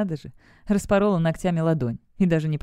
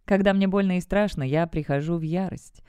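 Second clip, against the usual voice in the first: ordinary delivery, nothing marked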